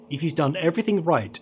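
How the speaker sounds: ordinary delivery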